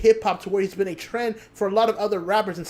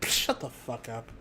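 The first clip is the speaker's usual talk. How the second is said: much softer and lower, slow; quiet dismissal; 9 semitones lower, 11 dB quieter than usual. Real, not acted. Telling the wrestling crowd to shut up, quiet dismissal. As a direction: drop it low and dismissive.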